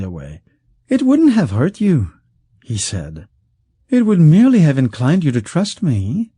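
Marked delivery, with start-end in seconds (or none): 0.9-2.1 high, smooth, coaxing voice of a wealthy schemer; silky rich man. Acted. Wealthy schemer coaxing the outlaw, high and silky. 3.9-6.3 high, smooth, coaxing voice of a wealthy schemer; silky rich man. Acted. Wealthy schemer coaxing the outlaw, high and silky.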